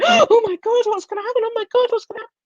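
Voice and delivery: gasp, then high-pitched frightened repetition; acted high, gasping panic; panicking inner child. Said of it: "our inner child is going".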